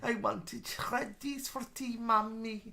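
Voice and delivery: put-on high, soft, slow child voice; Keeping Faith impression. Child to mummy about tea, high soft parody voice. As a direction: play a small child pleading to mummy. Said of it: "that's our Keeping Faith impressions".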